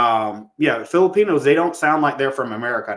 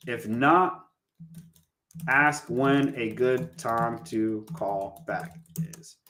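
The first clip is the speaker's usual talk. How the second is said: usual speaking voice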